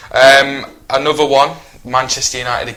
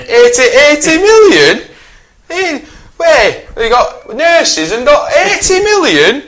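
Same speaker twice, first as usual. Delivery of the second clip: high, slow mocking squeak; 7 dB louder, slower than usual. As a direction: squeak it out in mock disbelief. Mock outrage over transfer fee.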